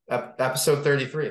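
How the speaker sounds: ordinary talk, nothing marked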